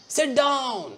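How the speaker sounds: loud high shouted command; said aloud. Shown as a demonstration. Teacher shows a loud order, shouting. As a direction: shout it across the room. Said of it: "I said aloud".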